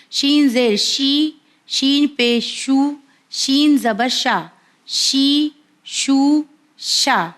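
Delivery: شین زیر شی drawn out slowly; slow letter drill. Shown as a demonstration. Teacher drills viewers on شین, slow and deliberate. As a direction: spell each, then drag شی شا شو out very slowly. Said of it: "next حرف ہمارا بھی شین ہے".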